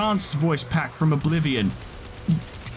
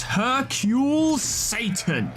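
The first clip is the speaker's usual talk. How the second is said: high, drawn-out hammed-up announcement; slow, high-pitched showman's reveal; hyped name announcement; 6 semitones higher, much slower than usual. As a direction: stretch the name out big, like introducing a champion. Hypes a champion's voice pack.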